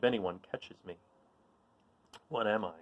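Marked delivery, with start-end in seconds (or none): none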